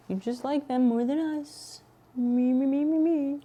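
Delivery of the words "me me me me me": mock whine. Pretend jealousy over viewer.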